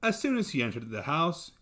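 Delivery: ordinary delivery, nothing marked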